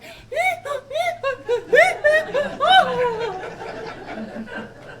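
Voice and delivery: put-on high sobbing wail of a little girl. Little sister wails to mama, high sobbing wail. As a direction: wail like a crying child. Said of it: "It came out kinda like".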